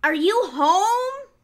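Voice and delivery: high, loud, slow call; yelled. A narrator's reading. Visitor outside yelling for Rabbit. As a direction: call out to the house. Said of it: "someone yelled outside".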